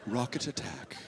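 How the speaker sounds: low, soft British announcer lady; lightly voiced, very proper British female announcer; proper British woman. British siren announcement to troops in Kandahar, proper and composed. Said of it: "A very proper British woman's voice".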